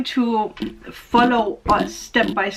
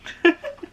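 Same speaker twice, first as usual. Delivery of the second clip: a brief high laugh; light moved laugh; 8 semitones higher than usual. Real, not acted. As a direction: laugh off the emotion. Laughs after lyrics.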